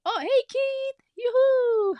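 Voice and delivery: high drawn-out yoo-hoo greeting; sing-song call. Real, not acted. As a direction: call out to him across the room. Calling out to viewer Keith.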